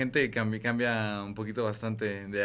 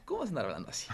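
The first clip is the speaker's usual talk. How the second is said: soft, higher scolding aside; mock disapproval; 4 semitones higher, 7 dB quieter, faster than usual. Brother's accent teased, soft mock reproach. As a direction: tease a brother for his new accent, softly. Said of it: "le escucho el acento y digo".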